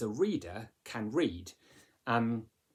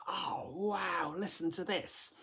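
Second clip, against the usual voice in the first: higher, breathy wonder with "wow" whispered; mock hushed excitement; thrilled; 7 semitones higher, slower than usual. Shown as a demonstration. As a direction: share a sudden delight. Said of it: "this unexpected thrill".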